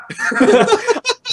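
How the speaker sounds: high-pitched burst of laughter; real high laugh. Real, not acted. Cracking up at co-host's remark.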